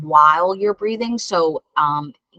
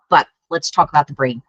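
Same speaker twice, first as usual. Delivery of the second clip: normal talking voice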